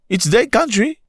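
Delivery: high mocking protest; mock indignant. Shown as a demonstration. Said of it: "You must go fix your country".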